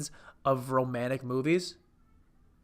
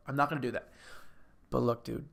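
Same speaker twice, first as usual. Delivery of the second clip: ordinary delivery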